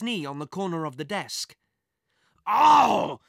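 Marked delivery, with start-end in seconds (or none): 2.4-3.2 much louder and higher pained cry; howl of pain. A narrator's reading. Director banging his knee on the desk, loud pained howl. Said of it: "he howled in pain".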